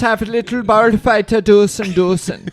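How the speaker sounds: high, loud mock German accent. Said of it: "starts talking like a German".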